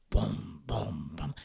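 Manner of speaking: low booming steps; deep booming footsteps; heavy bear steps. A narrator's reading. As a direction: stomp in like big bears.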